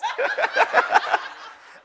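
loud, high-pitched cackling laugh. Real, not acted. Pastor after joking with the congregation, high cackling laugh. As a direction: burst into a high, rapid laugh. Laughing at his own joke.

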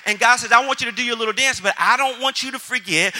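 loud, driving sermon voice with rising force; fiery preaching. Acted.

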